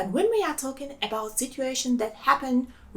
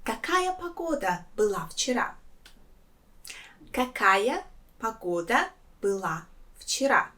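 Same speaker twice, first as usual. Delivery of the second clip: softer, какая погода была вчера rising at the end; model question intonation; 5 dB quieter than usual. Shown as a demonstration. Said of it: "I will ask you".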